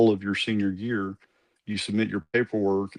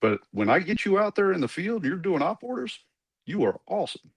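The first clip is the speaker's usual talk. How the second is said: put-on Colonel Waldo's thick Arkansas accent; 9 semitones higher than usual. Colonel weighs cadet's briefings against field work, thick Southern accent. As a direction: imitate the Arkansas good old boy colonel. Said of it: "really thick Southern Arkansas accent".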